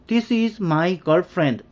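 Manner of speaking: spelled out very slowly; slow, clear English demo. Shown as a demonstration. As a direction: say each word slowly and clearly. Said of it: "이렇게 하겠죠".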